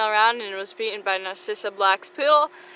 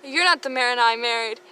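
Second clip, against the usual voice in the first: mock furious spouse; 6 semitones higher than usual. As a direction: play the betrayed spouse over a board game.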